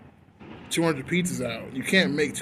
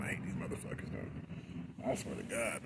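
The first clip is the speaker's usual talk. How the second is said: under-the-breath grumble at corporate; half-whispered complaint; hushed aside; 7 semitones lower, 12 dB quieter than usual. Real, not acted. Vlogger grumbling quietly so corporate won't hear, hushed. Muttered aside after fearing being fired.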